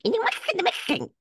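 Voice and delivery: very high, garbled mock Mexican accent; mock accent demo. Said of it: "can you say it like this".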